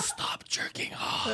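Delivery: hushed, unvoiced scolding whisper. Whispered librarian line.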